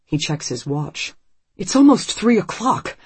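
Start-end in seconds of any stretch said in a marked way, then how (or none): 1.6-3.0 higher, louder, voice cracking; voice breaking. A narrator's reading. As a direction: let the voice break with worry. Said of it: "his voice breaking".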